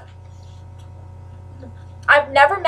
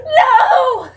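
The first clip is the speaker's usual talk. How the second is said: high laughing cry of "No!"; heartbroken no; 11 semitones higher, 3 dB louder than usual. Retold or imitated. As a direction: wail it half laughing, mock despair. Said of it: "I was so heartbroken".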